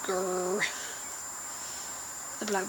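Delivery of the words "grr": soft playful growl. Pretend. Bored on Skype with friends, soft growl. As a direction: growl quietly like a bored animal. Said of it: "I'm like".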